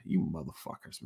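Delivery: soft, breathy grumble of betrayal; mock muttering at lying broadcasters; muttered curse. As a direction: mutter it under your breath, fed up. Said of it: "I was like".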